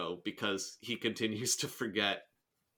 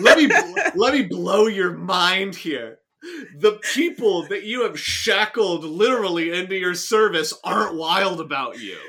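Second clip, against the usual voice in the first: loud emphatic; 6 semitones higher, 10 dB louder than usual. Real, not acted. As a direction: drive the point home loudly. Emphatic joking rant.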